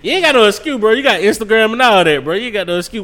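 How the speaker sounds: loud and high, playful scolding; loud teasing. Real, not acted. Teasing friend loudly.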